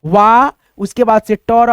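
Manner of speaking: slow, broken into वा and टर; syllable by syllable. Shown as a demonstration. Teacher slowly breaks the word down for students. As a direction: spell out water in slow parts. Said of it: "यानी पढ़ेंगे इसको कैसे".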